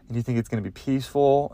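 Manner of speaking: ordinary delivery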